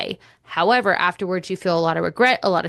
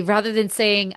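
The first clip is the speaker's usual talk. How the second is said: normal talking voice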